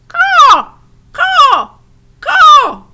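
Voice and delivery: very high, loud crow caws; crow screaming. A narrator's reading. Crow screams in Edward's ear, loud high caws. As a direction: screech like a crow. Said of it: "screamed a dark message".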